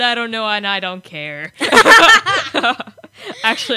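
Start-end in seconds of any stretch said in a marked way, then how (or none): 1.6-3.4 loud burst of laughter; bursting out laughing. Real, not acted. Laughing at own joke.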